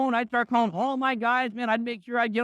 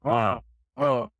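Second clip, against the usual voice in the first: dismayed groan; 3 semitones higher than usual. Retold or imitated. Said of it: "Gary's going".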